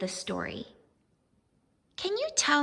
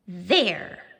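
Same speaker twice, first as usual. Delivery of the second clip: a sharp, louder, defiant There; defiant jab; 4 semitones lower, 4 dB louder than usual. Acted. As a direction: jab the word at the paper. Vashti jabs the paper.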